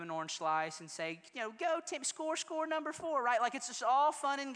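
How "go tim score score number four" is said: light soccer mom cheer. Retold or imitated.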